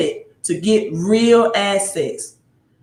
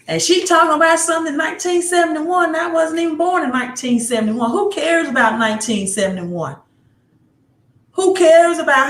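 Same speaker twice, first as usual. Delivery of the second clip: put-on Mississippi accent; 8 semitones higher, faster than usual. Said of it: "That's my Mississippi standard accent".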